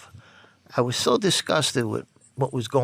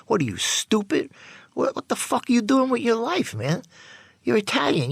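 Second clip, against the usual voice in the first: berating his friend; 10 semitones higher than usual. Retold or imitated. Berating friend after pulling needle.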